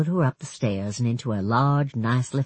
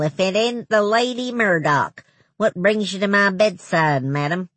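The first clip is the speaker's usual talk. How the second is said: put-on cranky old man; 6 semitones higher than usual. Thurlow mocking greeting.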